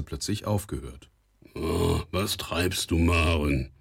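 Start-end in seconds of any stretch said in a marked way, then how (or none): 1.5-3.7 slow, groggy drawn-out question; just woken father, words dragged out; drowsy, slow call from bed; sleepy. A narrator's reading. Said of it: "mit schläfriger Stimme".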